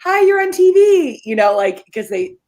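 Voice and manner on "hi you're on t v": high, loud cheery hello; bright greeting to a kid. Retold or imitated. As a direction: greet a child on Zoom, playful. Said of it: "I always go".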